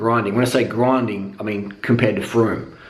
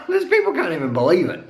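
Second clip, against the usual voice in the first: high, laughing delivery; pitched up with real laughter; amused disbelief; 7 semitones higher than usual. Real, not acted. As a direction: laugh through it at others' bafflement. Laughs at the baffled people.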